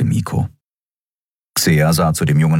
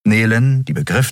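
plain reading voice

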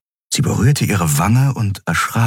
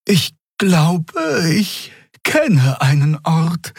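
high, drawn-out tentative speech; high-pitched, halting suggestion; hesitant figure voice; 10 semitones higher, much slower than usual. Acted. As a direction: offer the idea slowly in a high voice. Xea offers a refuge.

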